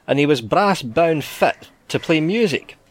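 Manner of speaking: ordinary delivery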